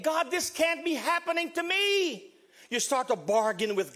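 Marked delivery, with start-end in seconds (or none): 0.0-2.3 high-pitched cry of protest to God; acted-out denial, pitch raised; disbelieving denial. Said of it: "there's denial".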